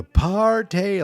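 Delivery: high, loud party whoop; party cheer. Retold or imitated. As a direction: yell it like a beer commercial. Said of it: "they're like in a beer commercial".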